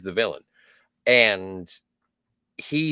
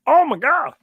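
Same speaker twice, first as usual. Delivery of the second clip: high, loud exasperated outburst; mock indignation; 14 semitones higher, 6 dB louder than usual. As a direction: cry out in disbelief, very high-pitched. Acting Renard's indignant response to M.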